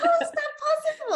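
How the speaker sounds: high, laughing mock outrage; laughing disbelief. Quoting herself to her partner, laughing in disbelief. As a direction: squeal it through a laugh in disbelief.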